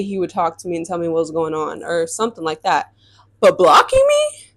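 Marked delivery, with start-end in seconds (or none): 3.4-4.5 high incredulous squeal on blocking; can't believe it, voice shoots up; shocked disbelief. Real, not acted.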